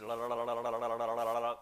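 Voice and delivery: rhythmic la-la gibberish imitating her talk; lightly mimicked rhythmic babble; mock foreign chatter. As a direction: rattle off la la la like a fast foreign tongue. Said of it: "she talked like this".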